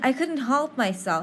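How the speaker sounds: ordinary talk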